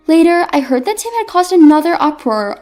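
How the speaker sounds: plain reading voice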